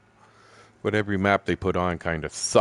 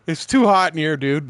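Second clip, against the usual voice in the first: mock complaint; 9 semitones higher, 7 dB louder than usual. Friend complaining in hot hut.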